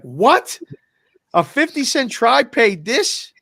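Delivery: high, loud, drawn-out disbelief; stunned outcry rising at the end; incredulous. Retold or imitated. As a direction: imitate a flabbergasted clerk. Clerk stunned by payout.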